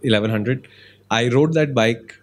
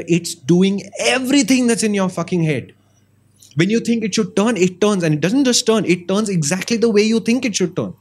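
rising higher, swept up in it; fired up; 7 semitones higher than usual. Real, not acted. Host telling listeners what the perfect bike does, fired up. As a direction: build the passion line by line. Passionate pitch for the dream bike.

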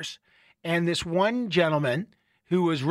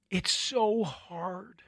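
weary gasp; 6 dB quieter, much slower than usual. Retold or imitated. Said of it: "he looked at us and he said".

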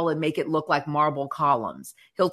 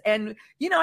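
ordinary delivery

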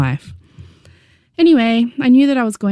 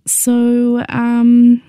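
hesitant, whispered start; 7 semitones higher, 4 dB louder than usual. A narrator's reading. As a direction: hold back, unsure how to say it. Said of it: "she said very hesitantly".

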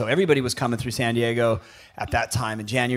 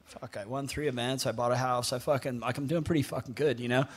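muttered under the breath, much softer; inner thought, quiet; 9 dB quieter than usual. Retold or imitated. Surfer thinking to himself while being taunted, softly. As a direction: think it to yourself, half laughing.

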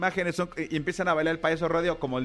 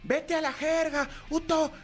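high, slow taunting shout; mock fan taunt; 9 semitones higher, slower than usual. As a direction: jeer like a fan across the street. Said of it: "por eso le decía". (grito burlón de aficionado, alto y lento)

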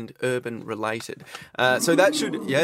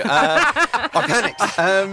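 laughing; 6 semitones higher, 7 dB louder, much slower than usual. Real, not acted. Host laughs at own joke.

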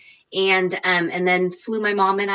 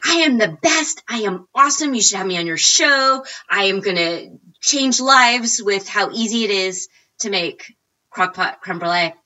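cocky boast; 3 semitones higher, 4 dB louder than usual. Retold or imitated. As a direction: ham up the all-caps self-promotion. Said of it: "I'm like".

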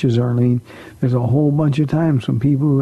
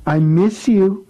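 tender affection; 5 semitones higher, slower than usual. Real, not acted. Pastor to a little girl who phoned in, tender. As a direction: say it fondly, drawn out and lifted.

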